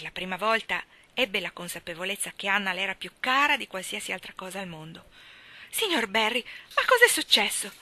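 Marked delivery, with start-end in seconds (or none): 5.7-7.7 high, loud, breathless cry; breathless alarm. A narrator's reading. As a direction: gasp it out in sudden fright. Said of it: "chiese senza fiato". (Marilla spaventata chiede al signor Barry, senza fiato)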